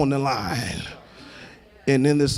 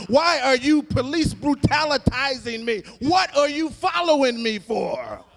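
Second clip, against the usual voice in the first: pleading questions that climb far higher into a preached cry; voicing Trayvon's plea; 8 semitones higher, 3 dB louder than usual. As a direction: let each question pull higher until it cries out. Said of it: "I can hear him say".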